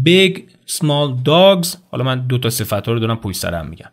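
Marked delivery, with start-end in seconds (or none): 0.1-1.8 "Big small dogs" very slow and higher; slow vocabulary reading. Shown as a demonstration. Teacher reading textbook vocabulary aloud. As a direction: read the pair slowly. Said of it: "درستش اینه که بگم big dogs".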